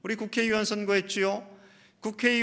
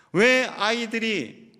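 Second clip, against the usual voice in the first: ordinary delivery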